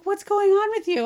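lightly voiced worried partner; puzzled partner voice. Partner asking why she is crying, high and loud. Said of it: "She's like".

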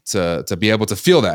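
ordinary talk

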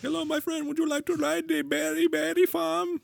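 high, hammed-up Middle Eastern accent, inviting; mock Middle Eastern accent. Knott's Berry Farm ride host inviting guests, mock Middle Eastern accent. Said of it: "use his real accent".

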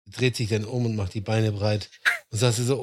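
normal talking voice

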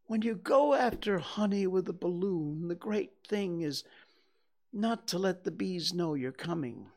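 a small, earnest bear working it out; Pooh voice. Acted. Narrator voices Pooh explaining.

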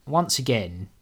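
ordinary talk

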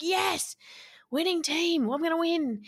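high-pitched, gleeful re-enacted cheer; imitating a thrilled winning-team cheer; excited cheering. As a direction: relive the triumph, pitch leaping up. Reliving cheer at friend's fight win.

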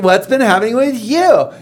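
high, sing-out imitation; mock gushy small talk. Husband mimicking wife's coworker catching up, mock gushing.

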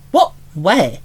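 ordinary delivery

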